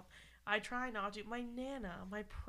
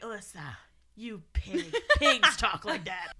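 slow, laughing mock-scold, louder at the end; imitating mom; 9 dB louder, slower than usual. Mom teasing her daughter for swearing, slow laughing scold. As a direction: drag out the insult with a laugh. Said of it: "then she's like".

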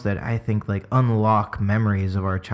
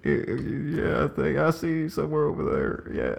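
put-on softer, higher creaky old politician; Bill Clinton; 4 semitones higher, 4 dB quieter than usual. Pretending to spot his wife, creaky mock presidential voice. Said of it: "it's a little creaky".

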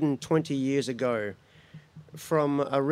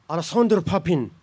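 put-on French accent; 4 semitones higher, 4 dB louder, faster than usual. Ancestor answers with French accent.

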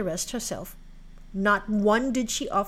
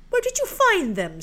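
demanding stepsister; 10 semitones higher, 5 dB louder than usual. Acted.

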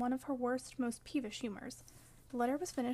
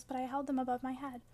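plain reading voice

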